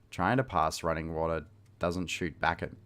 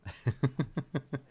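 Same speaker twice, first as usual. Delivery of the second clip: high, light staccato giggle; laughing; 7 semitones higher than usual. Real, not acted. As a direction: let out a quick run of giggles. Laughs between stories.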